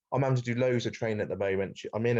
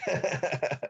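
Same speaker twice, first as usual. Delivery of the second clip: short laugh; 6 semitones higher than usual. Real, not acted. Laughs after "it's mid.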